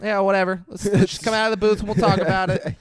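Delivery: high-pitched imitation of a woman; Kelly. Girlfriend dismisses the confession, in a high mock voice. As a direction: pitch it way up, shrugging it off.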